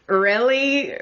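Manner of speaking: high, disbelieving question; incredulous really. Retold or imitated. Retelling her reaction to the pocket drawing, incredulous. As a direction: scoff at the idea in mock disbelief.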